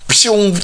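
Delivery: quick, high "pshoo" sound effect; whoosh of running off. Retold or imitated. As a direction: zip away with a sound.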